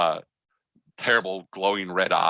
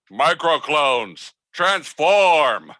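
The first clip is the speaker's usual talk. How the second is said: slow, loud, high transformation call; robot battle cry; 7 semitones higher, 8 dB louder, much slower than usual. Acted. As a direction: announce it like a toy robot powering up.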